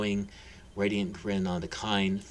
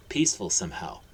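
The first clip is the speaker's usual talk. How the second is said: usual speaking voice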